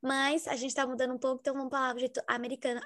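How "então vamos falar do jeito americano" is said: ordinary talk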